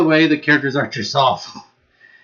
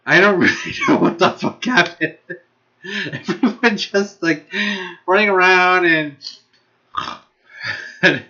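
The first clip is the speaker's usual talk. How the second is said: higher-pitched words squeezed out through laughs; real laughter; 3 semitones higher than usual. Real, not acted. Vlogger baffled by the plot, laughing. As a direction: struggle to talk while cracking up. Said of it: "I'm laughing because it is bad".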